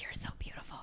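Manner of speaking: breathy whisper of awe; inner whisper. Retold or imitated. Staring at Paul Rudd at lunch, whispering. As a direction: whisper it, starstruck. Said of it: "in my head, I'm like".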